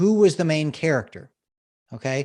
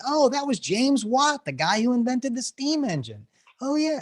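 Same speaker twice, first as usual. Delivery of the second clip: much higher voice of dawning recognition; mock surprised realization; 10 semitones higher than usual. Audience learning who the boy was, mock surprise. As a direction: act out a listener suddenly getting it. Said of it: "were probably surprised at the end".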